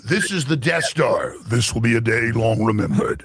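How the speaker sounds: slow, portentous villain proclamation; film villain. Acted. Quoting the Death Star villain on a historic day, ominous. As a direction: intone it like a movie villain.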